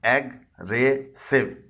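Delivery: एग्रेसिव stretched slowly into three syllables. Shown as a demonstration. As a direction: split एग्रेसिव into slow separate beats. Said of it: "इसके तीन हिस्से हैं".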